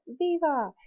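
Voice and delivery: high crowd cheer, mock; crowd's shout. Teacher imitating the crowd shouting back, high. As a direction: answer back like the whole plaza. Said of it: "everybody would just shout".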